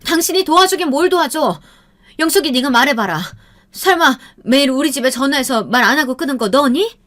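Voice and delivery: high, loud accusing shout; mother yelling at the girl, demanding the truth; angry yelling. A narrator's reading. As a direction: corner her with a furious yell. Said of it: "흥분한 어머니는 영숙이를 몰아붙이고 소리를 질렀어요".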